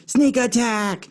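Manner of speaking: high, slow mock battle cry; yelling friend. Friend sneaking up behind, yelling a slow high battle cry. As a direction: stretch out a playful sneak-attack yell. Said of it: "and he yells".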